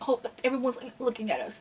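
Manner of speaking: small, quiet mock-worried aside; mock self-conscious worry. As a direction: drop the volume, imitating someone worried about onlookers. Mocks character's self-conscious worry.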